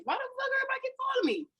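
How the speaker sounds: high, fast panicked question; quick high frantic question; retold alarm. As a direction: rattle it off, confused and alarmed. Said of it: "I'm like".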